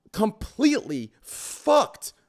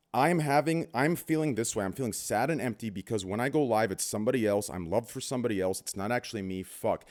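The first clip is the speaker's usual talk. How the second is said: low, soft, downcast inner monologue; understated gloom, pitch dropped right down; acted dejection; 10 semitones lower, 6 dB quieter than usual. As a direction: voice someone privately admitting emptiness. Voices an unhappy streamer's thoughts.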